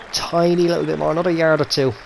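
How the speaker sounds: loud high pleading; willing the ball on; urging. Real, not acted. Urging ball onto green.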